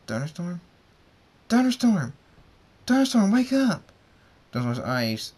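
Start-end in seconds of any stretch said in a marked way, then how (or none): 0.1-0.6 higher, rising questioning voice; checking on a sleeper; tentative call. A narrator's reading. Tentatively waking a dreaming sleeper. 1.5-3.9 high, loud wake-up yell; insistent full-volume call; urgent shout. A narrator's reading. Urgently waking someone from a nightmare.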